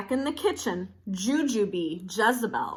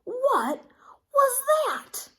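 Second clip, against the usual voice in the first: very high, louder startled squeak; 3 dB louder, slower than usual. A narrator's reading. Kitten startled by his reflection, shrill squeak. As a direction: squeal it in shock.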